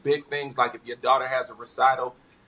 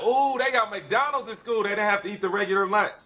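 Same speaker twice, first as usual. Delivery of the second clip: put-on high, fast, excited kid voice; high-pitched childlike awe, rushed; kid excitement; 7 semitones higher than usual. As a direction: gush like an impressed schoolkid. Kid excited about school McDonald's.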